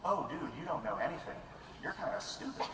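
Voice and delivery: low, soft self-doubt; little inner voice. Acted. Little voice inside the researcher putting them down, soft and low. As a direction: murmur it like a nagging inner critic. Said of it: "a little voice in me says".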